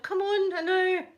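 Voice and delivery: high, loud self-rebuke in her head; scolding herself. Retold or imitated. Scolding herself inwardly for staring, high and loud. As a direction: tell yourself off sharply for staring. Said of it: "I'm thinking to myself".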